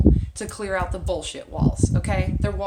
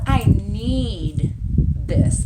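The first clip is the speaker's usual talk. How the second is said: very slow, dragged-out plea; mock needy plea; 5 dB louder, much slower than usual. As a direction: stretch each word like you can't let go. Person clinging to their bullshit.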